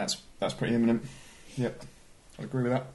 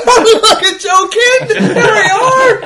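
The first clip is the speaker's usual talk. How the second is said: much higher, louder, slow laughing West Country voice; mock Bristol accent; 13 dB louder, much slower than usual. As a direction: ham up a Bristol yokel while laughing. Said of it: "why it's from Bristol".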